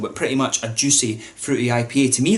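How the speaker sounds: ordinary delivery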